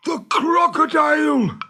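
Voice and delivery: high, loud fearful shout; pirate shrieking at the crocodile; terrified cry. Acted. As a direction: shriek in panic. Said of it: "gasped Hook".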